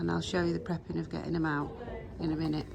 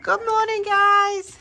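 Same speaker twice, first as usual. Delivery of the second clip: high sing-song greeting to animals; cooing to horses; 8 dB louder, slower than usual. Real, not acted. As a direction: coo it high and sweet, like to pets.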